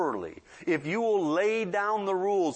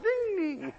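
high-pitched mock child's retort; cheeky child; 11 semitones higher than usual. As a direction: mimic a defiant little kid, pitched high. Child's cheeky reply to warning.

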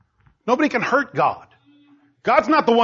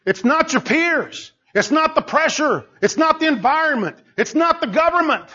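preaching build; 6 semitones higher than usual. Acted. Preacher telling the congregation it's their fault, building emphasis. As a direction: build it up line by line to the point.

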